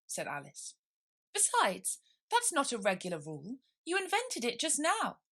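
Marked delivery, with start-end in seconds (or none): 1.3-5.2 put-on high, indignant young voice; indignant girl. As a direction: accuse him like a clever girl. Alice argues.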